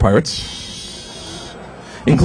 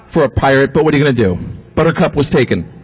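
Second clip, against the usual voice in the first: ordinary talk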